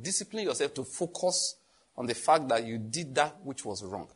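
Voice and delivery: normal talking voice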